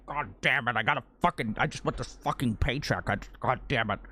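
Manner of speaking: put-on low, grumbling fed-up old man; grumpy Harrison Ford. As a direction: mutter like a tired old actor who wants his paycheck. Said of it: "he's like".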